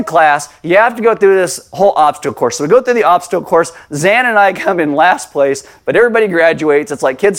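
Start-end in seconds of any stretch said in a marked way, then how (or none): none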